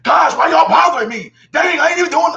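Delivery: high, loud whiny protest at the cop; exasperated complaint. Pretend. Imagined driver snapping at a cop, loud and whiny. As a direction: complain loudly like you're being hassled. Imagined angry reply to police.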